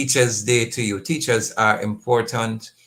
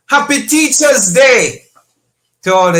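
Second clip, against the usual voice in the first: exuberant greeting; 10 semitones higher, 9 dB louder than usual. Real, not acted. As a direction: call it out big to every listener. Calling out a holiday greeting.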